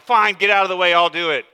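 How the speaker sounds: impatient Peter. Retold or imitated. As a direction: Peter barging past the others, fed up. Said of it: "He's like".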